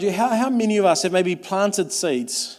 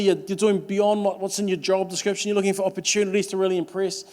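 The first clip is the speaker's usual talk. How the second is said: ordinary talk, nothing marked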